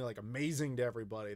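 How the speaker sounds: ordinary delivery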